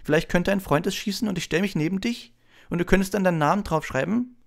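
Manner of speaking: high, eager begging; hopeful high-pitched plea; pleading Colin. A narrator's reading. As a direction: ask imploringly in a high boy's voice. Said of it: "flehentlich bittend".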